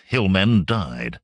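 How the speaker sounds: usual speaking voice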